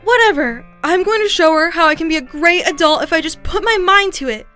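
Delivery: loud, fired-up declaration; defiant outburst. Acted. Defiant vow in an acted quarrel.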